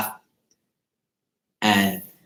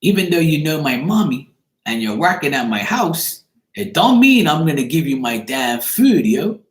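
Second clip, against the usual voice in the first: higher-pitched mock child standing her ground; imitated defiant little girl; 5 semitones higher than usual. Girl silently warning mom's co-worker off her food, imitated defiant little girl. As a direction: voice a kid guarding their food. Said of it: "she's looking at me like".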